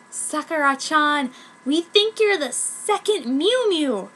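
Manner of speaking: lightly voiced confidant. Akasaka-san telling Sakura she is chosen. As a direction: lean in and share the secret.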